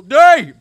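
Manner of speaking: high, loud yelped D; goofy falling yelp; silly D noise. Real, not acted. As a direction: yelp it like a cartoon fall. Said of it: "I make that when I fall down".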